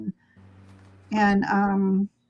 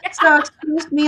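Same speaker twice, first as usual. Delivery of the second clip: ordinary talk, nothing marked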